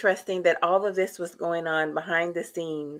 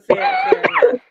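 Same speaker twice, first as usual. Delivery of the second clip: very high, squeaky falsetto; silly squeaky voice; 6 dB louder than usual. Pretend. Repeats phrase in silly high voice.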